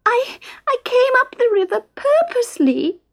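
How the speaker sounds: put-on high tearful little girl; weeping mermaid. A narrator's reading. Little mermaid weeping to Fred and Isa, very high. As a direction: sob it out like a hurt child. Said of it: "wept the little mermaid".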